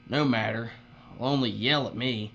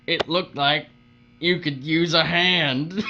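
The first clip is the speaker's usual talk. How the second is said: normal talking voice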